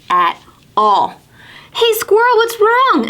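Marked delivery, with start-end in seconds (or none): 1.7-3.1 high, bright call; Pete calling out. Acted. Pete greeting his sad friend Squirrel, high and bright. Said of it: "asked Pete".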